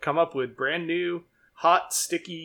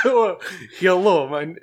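louder, laughing mock Russian hello; mock Russian accent; 4 dB louder, slower than usual. Introducing Gustav to the group, in a laughing mock Russian accent. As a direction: start a cartoon Russian intro, then catch yourself. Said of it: "that's Russian".

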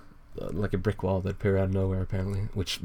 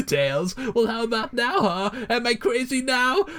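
crazy ranting villain; 8 semitones higher than usual. Acted. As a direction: pitch climbing from usual to very high mania. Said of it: "sounds pretty crazy".

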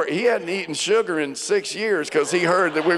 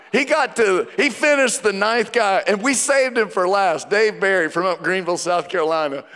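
usual speaking voice

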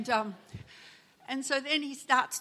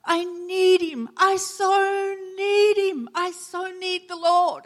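high, slow pleading cry; a woman crying out that she needs the Lord; 9 semitones higher, 8 dB louder, slower than usual. Retold or imitated. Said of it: "she started to cry out".

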